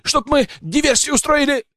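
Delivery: very high, strained squeal; panicked wail. A narrator's reading. Poacher confesses at knifepoint, high panicked wail. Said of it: "завопил браконьер".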